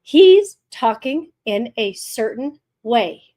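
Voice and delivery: deliberate, spaced-out broadcast delivery; slow, punched-out presenter rhythm; trained presenter cadence. Shown as a demonstration. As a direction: mimic a TV guide, word by word. Mimics tour guide's trained cadence.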